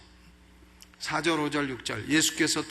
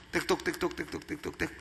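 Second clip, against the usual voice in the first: quick tongue-clicked tick-tock, softer; ticking clock; 6 dB quieter, faster than usual. Retold or imitated. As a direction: tick off the seconds with your tongue. Clock ticking while waiting for answer.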